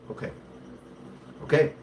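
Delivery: normal talking voice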